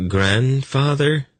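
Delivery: slow and sick with dread. A narrator's reading. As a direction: Grandfather stretched out in dismay. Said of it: "said in a sick tone".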